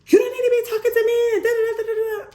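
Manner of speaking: high, loud mocking snap; mock snapping neighbor. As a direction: imitate her snapping back, shrill and high. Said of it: "she, like, snaps".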